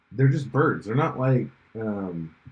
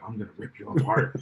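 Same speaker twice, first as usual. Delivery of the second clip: mock angry mama shark; 2 semitones lower, 4 dB quieter than usual. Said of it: "the mama Meg was like".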